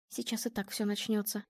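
very soft, anxious aside; whispering. A narrator's reading. Girl to dog before the row starts, whispering. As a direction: keep it hushed, dreading what comes. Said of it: "шептала ему девочка".

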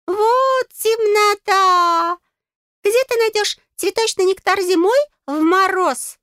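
high squeak opening drawn-out and loud; exasperated tiny fairy; Gustav, scornful. A narrator's reading. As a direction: scoff in a piping fairy voice. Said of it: "оскалился Густав".

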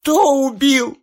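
high, loud sobbing cry; sobbing scream. A narrator's reading. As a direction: scream it while weeping. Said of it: "рыдая, кричала женщина".

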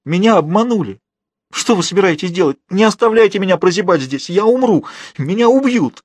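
high desperate begging, rising; tearful pleading. A narrator's reading. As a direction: beg for your life, climbing toward a wail. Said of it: "он зарыдал". (отчаянная мольба, голос всё выше)

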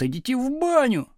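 high dismissive yell. A narrator's reading. Back-row pupils dismissing teacher, high yell. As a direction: call the brush-off out high. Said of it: "орали с задних парт".

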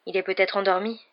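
ordinary delivery, nothing marked

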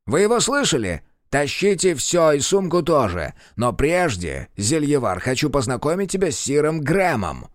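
a high-handed royal advisor giving orders. Acted. Court advisor speaking. (голос советника)

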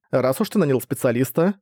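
plain reading voice

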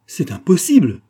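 high, loud cry of disbelief; a mother cries out at the death sentence; mother's cry. A narrator's reading. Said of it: "cria la mère".

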